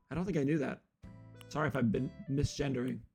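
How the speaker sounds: ordinary delivery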